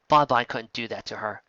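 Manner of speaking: ordinary delivery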